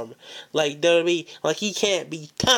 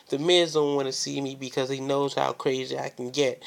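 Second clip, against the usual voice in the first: ordinary talk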